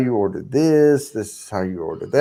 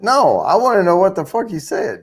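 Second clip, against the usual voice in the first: higher-pitched, emphatic demand; retold insistence; 7 semitones higher than usual. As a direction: replay yourself refusing to drop it. Acts out insisting on an answer.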